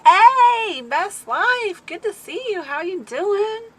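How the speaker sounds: high, loud, bright hello to a friend; excited greeting. Real, not acted. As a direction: call out happily to a friend. Greeting a viewer on stream.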